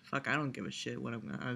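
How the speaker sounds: normal talking voice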